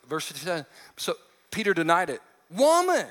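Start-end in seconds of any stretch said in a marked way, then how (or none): 2.5-3.1 sharp yelled Woman, higher and louder; retold yell. Peter yelling back at the servant girl, loud. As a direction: bark the word like an accused man. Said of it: "Peter yells back at her".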